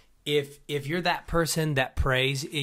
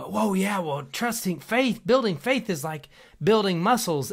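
raised-pitch parody of a know-it-all preacher; mock preacher; 5 semitones higher than usual. Mocking pastors who Googled working out, high mock preaching. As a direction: send up a pastor faking gym expertise. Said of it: "they do it in such a way".

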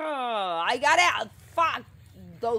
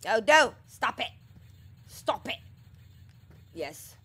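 ordinary talk, nothing marked

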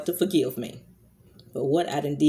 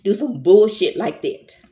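higher, louder burst of real indignation; emotional angry outburst; 4 semitones higher, 6 dB louder than usual. Real, not acted. Vlogger finishing her rant about a neglectful mother, emotional and angry. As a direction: hit it hard, worked up and disgusted. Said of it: "I'm getting emotional".

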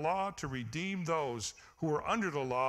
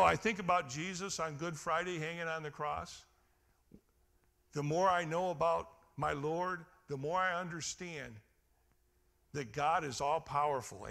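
ordinary talk, nothing marked

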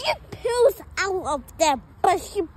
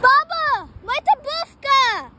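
calling out; 6 semitones higher, 6 dB louder than usual. Real, not acted. Calling grandma from outside.

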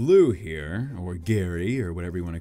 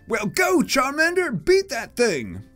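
high, urgent battle command; improvised rival voice; 4 dB louder, much slower than usual. Acted. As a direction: call the order out in a high voice. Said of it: "I forgot the voice for him already".

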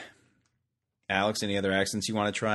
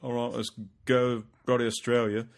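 put-on joking British convict accent; mock British accent; 2 semitones higher than usual. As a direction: play a Brit setting off by boat in a British accent. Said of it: "They sent the British people on this boat".

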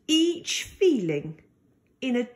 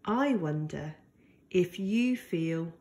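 softer, lower hushed question; soft bedtime ending; tender wondering; 7 semitones lower, 5 dB quieter than usual. Acted. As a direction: lean in and wonder gently aloud. Tender question closing the story.

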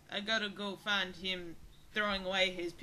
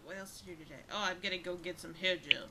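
normal talking voice

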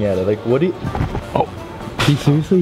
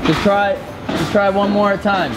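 high feigned helpfulness; fake innocent; 9 semitones higher than usual. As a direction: play dumb, high and eager.

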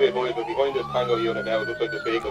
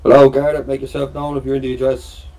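calling out; 3 semitones higher, 8 dB louder than usual. Real, not acted. Garda calling into the house.

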